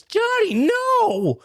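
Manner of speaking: very high, drawn-out mock cry; horrified dad. Dad catching his son, high horrified mock cry. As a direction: wail it up high, stretching the last word. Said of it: "And his dad says".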